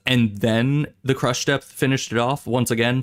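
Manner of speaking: ordinary talk, nothing marked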